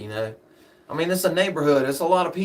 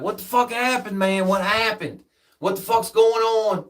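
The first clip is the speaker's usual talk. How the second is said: alarmed yelling; 8 semitones higher than usual. Retold or imitated. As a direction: demand answers right after the shot. Son rushing down after gunshot.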